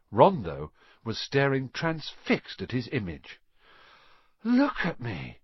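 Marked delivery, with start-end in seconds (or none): 4.4-5.4 awestruck. A narrator's reading. Ron transfixed by his image.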